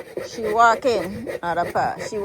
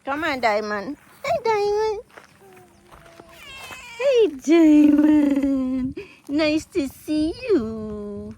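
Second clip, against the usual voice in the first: much higher cooing voice, drawn out; high pet-greeting sing-song; pet talk; 11 semitones higher, 4 dB louder than usual. Real, not acted. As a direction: coo sweetly to a returning cat. Greeting the cat Diamond.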